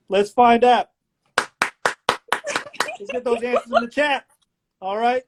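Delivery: showy quiz host working the crowd; game-show host. Acted. Host asks chat to vote, game-show delivery. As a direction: build suspense before the reveal.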